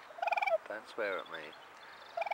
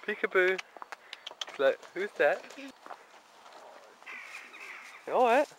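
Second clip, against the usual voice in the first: put-on high cooing baby talk; 10 semitones higher, 4 dB louder than usual. Cooing to animal.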